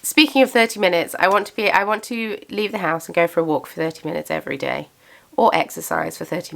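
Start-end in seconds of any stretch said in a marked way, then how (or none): none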